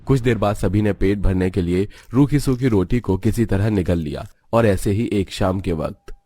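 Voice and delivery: ordinary delivery, nothing marked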